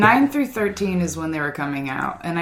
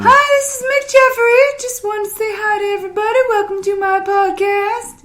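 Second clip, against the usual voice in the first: much higher, loud mock DJ voice; high mock radio voice; 5 dB louder than usual. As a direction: ham up a cheesy radio host. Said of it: "Let me a higher voice".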